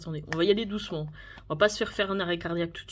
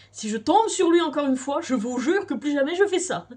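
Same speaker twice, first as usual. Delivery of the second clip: exasperated; 6 semitones higher, 6 dB louder than usual. Real, not acted. Frustrated vow about Woo Young.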